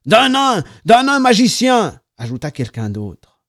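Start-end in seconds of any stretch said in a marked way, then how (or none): 0.1-2.0 high, loud eager exclamation; excited. A narrator's reading. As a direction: call it out louder and higher. Group of lads joking excitedly.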